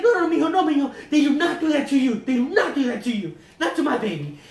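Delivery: lightly imitated indignant mother, starting high and loud, settling; mock maternal outrage with a Mexican accent; protective Mexican mom. Mom defends her baby, indignant Mexican accent. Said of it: "I gave her a Mexican accent".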